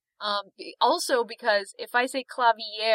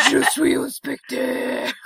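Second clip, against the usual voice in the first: French ghost voice; slower than usual. Pretend. French ghost bit again.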